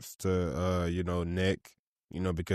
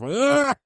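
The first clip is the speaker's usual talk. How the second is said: shrill, loud fake laugh mocking a friend; hammed-up imitation of a friend's laugh; mock high laugh; 8 dB louder than usual. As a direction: cackle high to tease his laugh. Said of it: "I do not laugh like that".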